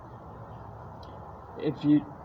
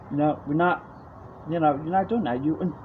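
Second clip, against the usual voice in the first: ordinary talk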